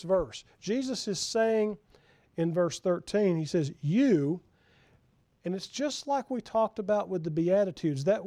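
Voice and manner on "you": You said with weight and a bit louder; 相手を指して You を強く; emphatic You. Shown as a demonstration. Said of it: "It's emphatic".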